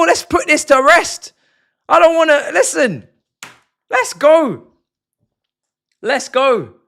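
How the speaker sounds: loud, high call to push on; rallying shout. Real, not acted. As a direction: yell at the team to go for a third. Urging players on, final minute.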